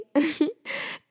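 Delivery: high, playful little giggle; a teasing giggle to break the tension. Retold or imitated. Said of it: "I would just giggle".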